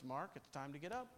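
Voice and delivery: soft quick mock dad wake-up call; quietly voicing Dad at the door, hurried. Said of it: "he would say something like this".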